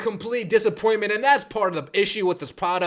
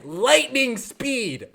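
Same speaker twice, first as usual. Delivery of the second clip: drawn out; 3 semitones higher, much slower than usual. Shown as a demonstration. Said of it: "slow emphatic repeat".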